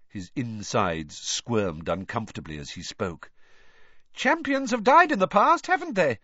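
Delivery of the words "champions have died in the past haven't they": high, loud, brisk reporter; pushy reporter pressing the boy; brisk Rita Skeeter. A narrator's reading. Reporter briskly pressing Harry about deaths, high and loud. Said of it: "said Rita Skeeter briskly".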